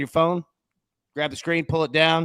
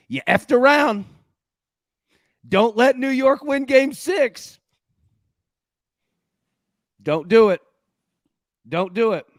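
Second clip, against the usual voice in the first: mock warning; 7 semitones higher, 5 dB louder than usual. Real, not acted. Host warns Indiana Pacers fans.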